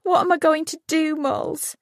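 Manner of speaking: high, sobbing plea to her friend. A narrator's reading. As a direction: sob the question out to Molly. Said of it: "she sobbed".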